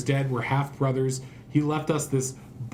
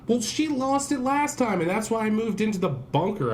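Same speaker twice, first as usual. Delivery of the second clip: high exasperated outburst; 7 semitones higher than usual. Real, not acted. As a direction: blurt it out, fed up. Exasperated outburst about girlfriend.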